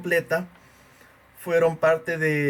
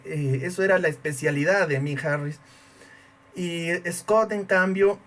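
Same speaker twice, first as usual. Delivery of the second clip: normal talking voice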